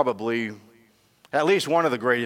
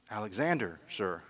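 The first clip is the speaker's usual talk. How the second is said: timid answer; 7 dB quieter than usual. Acted. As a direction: barely dare to say it.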